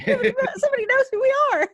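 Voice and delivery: laughing through it, pitched high; laughing giddy. Retold or imitated. Cohost laughing while echoing the moment, high. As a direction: giggle it out in delight.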